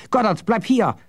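high, urgent call after someone; alarmed warning. Acted. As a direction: call him back in alarm. Jasper calls after fleeing Goddard.

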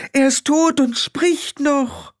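slow, very high wail; high-pitched alarmed wife; panicked. A narrator's reading. Wife panicking, calling down the hole.

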